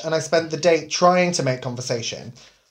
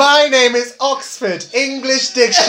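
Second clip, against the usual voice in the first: pompous voice; 7 semitones higher, 4 dB louder than usual. Pretend. Joins the pretend introductions.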